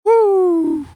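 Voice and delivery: cheer. Real, not acted. Host cheers after pitch.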